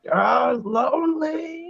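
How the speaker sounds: high, slow sneering drawl; snickering jackal voice. Retold or imitated. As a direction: draw out the name like a sneering jackal. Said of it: "he's like, snickering".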